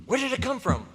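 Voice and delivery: frightened. Acted. Reporter sees huge flesh.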